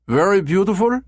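put-on high, eager German-accented caller. A narrator's reading. Caller asks about the redheads, high German accent. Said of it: "he repeated in his German accent".

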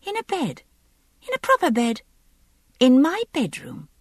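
put-on high-pitched, impatient young lady. A narrator's reading. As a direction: spell it out in pieces, pitched high. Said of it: "she said impatiently".

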